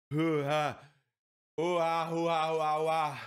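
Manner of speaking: short puffed breaths to cool the mouth; panting through the burn. Real, not acted. Mouth on fire, puffing air to cool it down. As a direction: puff it out in quick breaths.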